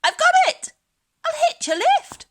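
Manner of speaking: high, loud eureka cry from the tiny snail; excited cry. Acted. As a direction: cry out with a sudden bright idea, fast on the second line. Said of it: "then cried".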